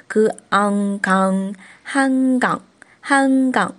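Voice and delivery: 그, 앙, then 강, then whole 한강; sounding out. Shown as a demonstration. Teacher building Han River for learners, 그-앙-강 sounded out into 한강. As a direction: spell out 그 and 앙, blend to 강, then say 한강. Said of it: "这样拼读出来的".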